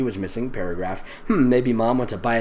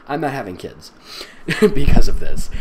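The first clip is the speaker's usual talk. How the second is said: normal talking voice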